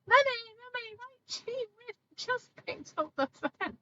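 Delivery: put-on high whiny kid voice calling Mummy; imitating small child. Small child nagging mum on the phone, high whiny voice. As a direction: pitch way up like a child tugging at mum.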